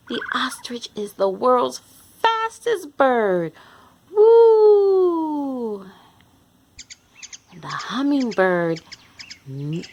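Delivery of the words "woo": loud high whoop of delight; excited woo. Acted. Reading to kids, a loud excited whoop. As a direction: cheer like something zoomed past. Ostrich fastest bird.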